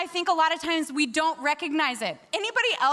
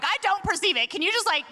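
higher-pitched exasperated plea; mock exasperation; 5 semitones higher than usual. Speaker answering the Lord, mock exasperation. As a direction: protest to God with comic frustration.

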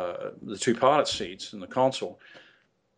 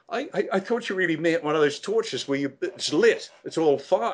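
higher, lightly voiced amazed trainee; 6 semitones higher than usual. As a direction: play the baffled trainee, higher. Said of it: "He said".

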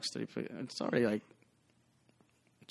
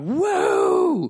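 high, loud mock party whoop; drunk partier whoop; 9 dB louder than usual. Bartender imitating drunk Burning Man guests, loud whoop. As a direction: whoop like a wasted reveller. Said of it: "just being like".

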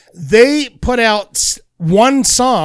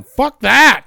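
1 retold freak-out; 6 semitones higher, 7 dB louder than usual. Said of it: "freaking out".

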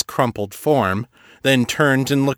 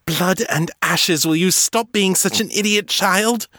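exasperated scolding; 9 semitones higher than usual. Acted. Morgan exasperated at Ezekiel.

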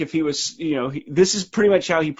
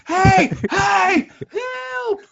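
trapped shouting for help; much slower than usual. Pretend. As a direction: yell for help from behind glass. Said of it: "waving like we're in the Phantom Zone".

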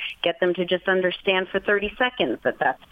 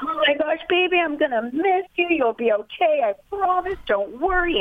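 sugary high voice, fussing over the dog; overdone baby-talk goodbye; high-pitched anxious fussing; 8 semitones higher than usual. Acted. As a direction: smother the dog with reassurance, voice way up. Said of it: "your voice goes up ten octaves".

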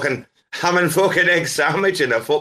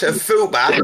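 high-pitched, incredulous echo; baffled shock; 7 semitones higher, 3 dB louder, slower than usual. Real, not acted. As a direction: throw the word back high, in disbelief. Shocked at an unknown word.

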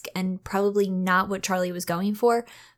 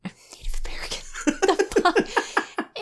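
breathless, voiceless laugh through the words; laughing disbelief; 11 semitones higher than usual. Real, not acted. Host catches her slip about the accent, real incredulous laughing. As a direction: echo your own blunder, dissolving into whispery laughter.

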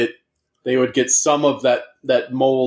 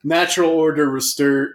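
ordinary talk, nothing marked

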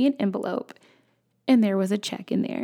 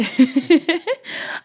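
burst of laughter; 7 semitones higher, 4 dB louder than usual. Real, not acted. Laughs at own anger.

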